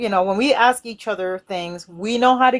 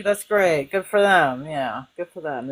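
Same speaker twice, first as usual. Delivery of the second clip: begrudging; 5 semitones lower, slower than usual. Retold or imitated. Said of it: "kind of begrudgingly telling you".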